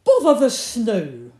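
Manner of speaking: loud, exasperated rabbit exclamation; annoyed rabbit. A narrator's reading. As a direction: burst out crossly at the weather. Annoyed Rabbit rushing home.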